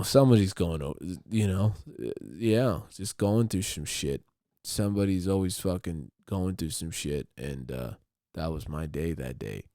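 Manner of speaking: ordinary talk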